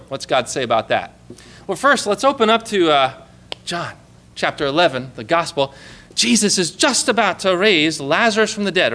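ordinary delivery

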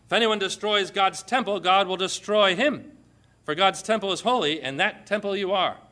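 loud, declaiming preacher voice; fiery sermon delivery; forceful scripture preaching. Acted. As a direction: proclaim it from the pulpit.

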